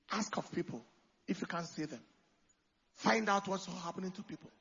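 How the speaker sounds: ordinary talk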